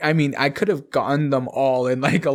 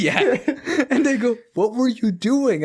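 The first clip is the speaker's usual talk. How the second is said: laughing; 10 semitones higher than usual. Real, not acted. Laughing while retelling friends' question.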